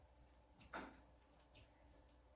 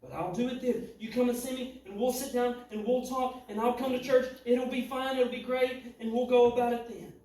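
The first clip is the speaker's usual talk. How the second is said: higher and louder, voicing the lady's promise; full-force preaching; 5 semitones higher, 8 dB louder than usual. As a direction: preach her promise out, climbing in pitch and volume.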